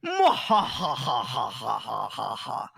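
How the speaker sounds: slow, drawn-out villain laugh; evil cackle. Pretend. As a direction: cackle like a mad scientist. Villain laugh after evil plan.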